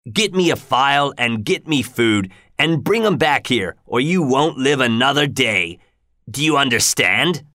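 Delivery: rough, menacing orders, slowing at the end; threatening convict. Acted. Convict ordering Pip to bring a file and food, menacing. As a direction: scare the boy into obeying.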